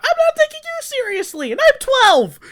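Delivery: very high, loud bratty yell; twelve-year-old yelling at the driver; kid yelling. Retold or imitated. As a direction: shout it like a defiant kid. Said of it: "I'm just, like, yelling at this man".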